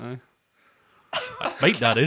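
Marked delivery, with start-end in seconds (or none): 1.4-2.1 loud Somerset "That's bite, that is" with "bite" rising; Somerset accent. Acted. Friend imitates a Somerset cider drinker loudly in Somerset accent. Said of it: "a kind of Somerset cider type of guy".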